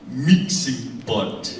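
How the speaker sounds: MIXING POT very slow and low; savoured words. Shown as a demonstration. Host relishing a word they love, slow, savouring MIXING POT. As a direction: roll "Mixing pot" slowly off the tongue. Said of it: "the way it triples off of your tongue".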